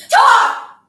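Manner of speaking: very high, loud shriek; desperate scream. Acted. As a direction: shriek it as a tearful command. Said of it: "Then I screamed".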